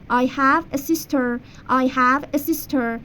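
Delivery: slow teacher demo of the sentence; slow model sentence. Shown as a demonstration. Teacher modelling English for Persian students, slow. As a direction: say it slowly for repetition. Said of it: "من یه خواهر دارم".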